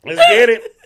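loud, high barber calling next; hype barber voice. Pretend. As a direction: shout out next like a hyped barber. Playing barber calling next client.

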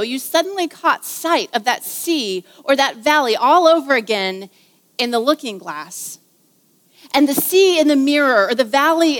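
put-on warm, lively read-aloud of a children's book; story reading aloud. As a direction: read it like story time, savouring each line. Little story time with April.

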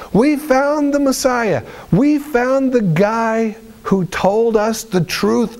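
high, stretched-out exultant proclamation; thrilled disciple spreading the news; excited announcement. Acted. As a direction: announce it high and slow, overjoyed. Disciples announce finding Messiah to Simon.

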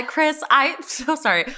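ordinary talk, nothing marked

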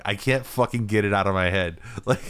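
ordinary delivery

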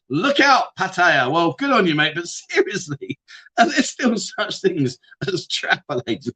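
usual speaking voice

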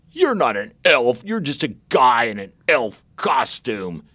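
mildly mocking schoolkid challenge; lightly voiced big kid. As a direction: imitate a kid calling someone out. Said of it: "the biggest kid in the class said".

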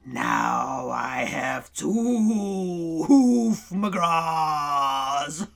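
low, slow, gloating cat; grinning cat voice. Acted. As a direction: drag it out like a smug beast. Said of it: "grinned and said".

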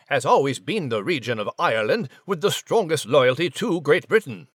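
learned, hesitant English gentleman explaining; British gentleman mouse. Acted. British gentleman explains Irish loyalty to the Crown, pompous and measured. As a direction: hold forth as a stuffy British scholar.

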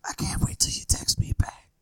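breathy whispered lyric. Acted. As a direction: whisper it like a love song. Whispered song lines.

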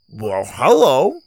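talking through a bite; slow words round a mouthful of food; mouth full. Acted. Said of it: "said with a mouthful".

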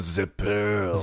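imitating a bad French accent; hammed-up French accent. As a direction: put on a comic French accent. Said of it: "that was a great French accent".

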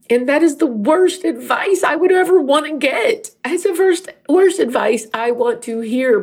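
high-pitched tearful protest; crying. Real, not acted. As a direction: blurt it out through real tears, voice up high. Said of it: "'cause I'm crying".

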